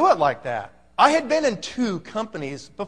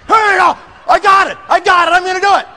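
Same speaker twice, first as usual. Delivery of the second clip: much higher and louder, bursting with hype; pumped up; 8 semitones higher, 9 dB louder than usual. Retold or imitated. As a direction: shout it like you're fired up. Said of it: "Guys all pumped up".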